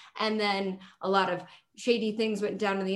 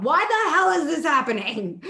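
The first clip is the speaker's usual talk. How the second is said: mock yell at TV; 8 semitones higher, 7 dB louder than usual. Yelling at the TV at the bar.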